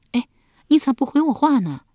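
high-pitched wife pressing for an answer; voiced wife, insistent. Wife asks her silent husband for an answer, insistent. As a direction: press him in a high wife's voice. Wife impatient at being ignored.

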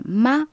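"ma" held high and level; first tone. Shown as a demonstration. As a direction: sustain "ma" on one high pitch. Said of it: "le premier ton".

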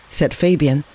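ordinary delivery, nothing marked

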